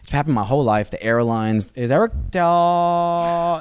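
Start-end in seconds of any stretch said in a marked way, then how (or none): 1.7-3.6 high, stalled name announcement, last syllable dragged out; baffled airline announcer. Retold or imitated. Airline staff calling out an unfamiliar Italian surname, baffled. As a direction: get stuck on the surname, holding it high and long. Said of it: "they don't know what's going on".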